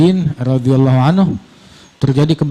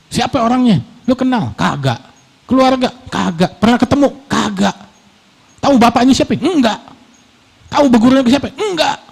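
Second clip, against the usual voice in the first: high-pitched questions, dismissive replies; mock interrogation; 8 semitones higher than usual. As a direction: act out a quizzing back-and-forth. Acted quick question and dismissive answer.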